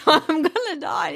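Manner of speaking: high, slow horrified patient, played for laughs; mock panic. Retelling a patient who misheard her doctor, high mock panic. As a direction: pitch it way up in pretend dread.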